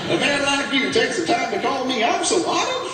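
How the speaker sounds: high-pitched mock-flattered voice; gushing reverend. Reverend gushes to threatening caller.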